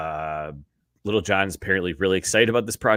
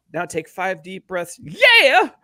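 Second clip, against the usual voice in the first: higher, louder hype-man yell on the yeah; 11 semitones higher, 7 dB louder, slower than usual. Retold or imitated. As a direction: shout it like a crunk hype man. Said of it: "more [name] [name] impressions".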